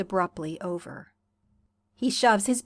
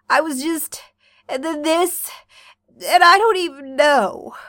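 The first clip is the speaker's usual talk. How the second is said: tearful; 6 semitones higher, 6 dB louder than usual. A narrator's reading. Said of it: "burst out sobbing afresh".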